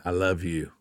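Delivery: hushed heartfelt I love you; tender. Shown as a demonstration. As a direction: say I love you gently, meaning it. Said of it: "said in a different way".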